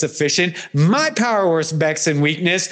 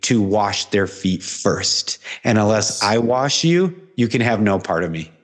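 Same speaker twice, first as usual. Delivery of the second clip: a gentle, much lower Jesus finally understood; Jesus speaking; 9 semitones lower than usual. Retold or imitated. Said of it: "and he goes".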